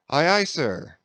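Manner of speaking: eager sailor's call; cheerful cry. A narrator's reading. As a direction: answer the captain with gusto. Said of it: "cheerfully cried little King Post".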